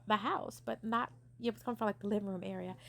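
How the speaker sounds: usual speaking voice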